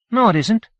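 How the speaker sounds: put-on a kid protesting eagerly; insistent child. Child Benny contradicts Howie.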